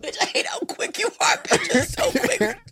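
high, breathless words through laughter; wheezing laugh. Real, not acted. Telling her friend how quick she is while still laughing.

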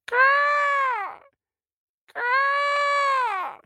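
high, piercing bird caw; eagle cry. A narrator's reading. Storyteller voices the eagle beating its wings, high caw. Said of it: "cries to the sky".

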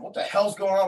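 normal talking voice

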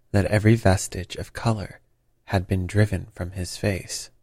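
ordinary delivery, nothing marked

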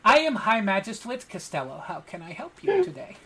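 put-on high, cute cat-boy magistrate; high little voice putting on formality; small cat boy official. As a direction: introduce yourself as a tiny, adorable official. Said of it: "adorable small cat boy".

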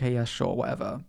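low-key, soft going-along; dismissive agreement. Retold or imitated. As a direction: humour them with half-hearted agreement. Acted indifferent agreement to him.

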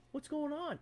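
high, soft little query; mock timid peek. Senior chief peeking out at the noise, mock timid hush. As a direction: ask it small and cautious, imitating.

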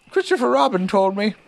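high-pitched bear explaining; knowing Pooh Bear. A narrator's reading. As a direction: explain it simply in a high character voice. Narrator voices Pooh.

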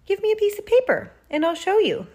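high, fast, loud offer; eager aunt. A narrator's reading. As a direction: rush in excitedly to show a nephew.

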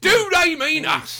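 high, slow, drawn-out mock indignation; mock-indignant Derek Jameson cry; Derek Jameson impression. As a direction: ham up the wounded tabloid outrage, slowly. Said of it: "remember Derek Jameson?".

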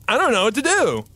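mock panic. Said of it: "Everyone was like".

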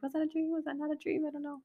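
high, soft self-questioning; retold embarrassed confusion. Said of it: "I'm embarrassed to ask".

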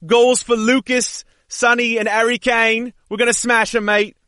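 high, mock Australian that lands on English; bad Australian accent. Co-host predicts a Tottenham win, bad Australian accent sounding English. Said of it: "started sounding English when he tried to sound Australian".

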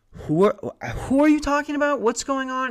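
high, loud, suspicious mom voice; startled mom. Retold or imitated. Mom at the door confronting a stranger, high startled voice. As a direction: squeak it out like a mom caught off guard.

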